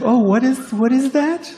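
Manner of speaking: high baffled voice; mock puzzled. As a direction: play it high and confused. Mock puzzled reaction to vegan.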